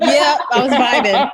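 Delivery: laughing loud through the words; giggly admission, laughing it off; high laughing reply. Real, not acted. Laughing about being tipsy.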